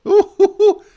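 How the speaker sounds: high loud mock-pain hoot; pained ooh. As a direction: wail in fake agony. Mock pain at bad stat.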